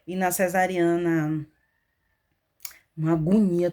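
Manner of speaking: normal talking voice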